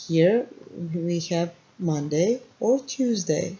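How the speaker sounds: ordinary delivery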